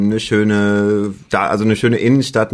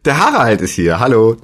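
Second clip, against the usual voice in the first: loud, higher cheerful greeting; shouting; 4 semitones higher, 6 dB louder than usual. Real, not acted. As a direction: yell the hello into the mic. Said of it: "direkt schreit er ins Mikrofon".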